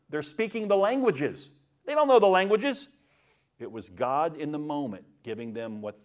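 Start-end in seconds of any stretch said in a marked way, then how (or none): 0.1-2.9 high, rising amazement, louder at the end; marveling. Acted. Astonished crowd marveling at uneducated men from Galilee. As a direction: build to loud, high-pitched disbelief. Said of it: "They were marveling".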